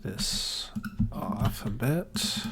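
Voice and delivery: quiet whispered building commentary; soft whisper. Acted. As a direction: whisper along as you place blocks. Whispers while building in game.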